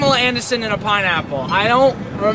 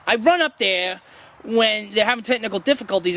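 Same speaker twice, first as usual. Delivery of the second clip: ordinary delivery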